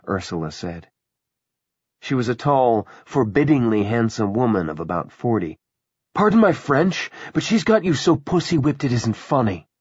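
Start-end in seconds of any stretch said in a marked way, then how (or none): 6.1-9.7 high, needling put-down; scornful jibe. Acted. Ursula telling David he is henpecked, scornful. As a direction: needle him in a raised, contemptuous voice.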